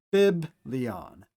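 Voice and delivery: biblion with a clear b and stress on the first syllable; Greek word. Shown as a demonstration. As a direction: say biblion slowly for the class. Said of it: "as in".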